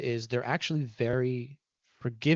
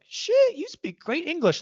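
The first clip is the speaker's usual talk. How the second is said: very high, loud burst of mock amazement; amazed reaction; 7 dB louder than usual. Listener hearing a learner's perfect accent, amazed. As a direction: exclaim it like you're hugely impressed.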